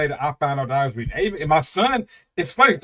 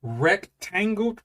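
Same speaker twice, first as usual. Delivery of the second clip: savoring slowly; 3 semitones higher, much slower than usual. Retold or imitated. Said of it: "I can't stop saying it".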